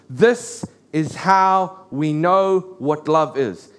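half-speed, word by word; slow, weighty quotation. Retold or imitated. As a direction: spell out the verse deliberately, pausing between phrases. Preacher quotes the defining verse slowly.

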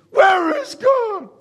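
high, loud, slow cry to the sky; desperate shout. Retold or imitated. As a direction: hurl the question out in anguish. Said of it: "a lot of people".

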